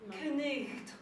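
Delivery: KNECHT with the K sounded before the N; Old English pronunciation. Shown as a demonstration. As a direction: sound every consonant in "knecht". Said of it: "it was probably pronounced".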